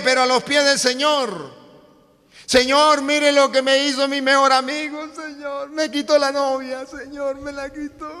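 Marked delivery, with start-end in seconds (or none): 2.5-8.1 hammed-up hurt complaint to God; mock tearful prayer. Youth complaining to the Lord about his best friend, mock tearful plea.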